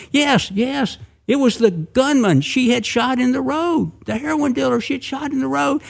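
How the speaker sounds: high-pitched, emphatic narration; excited reveal. A narrator's reading. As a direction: deliver the twist high and excited. Storyteller reveals the wounded man.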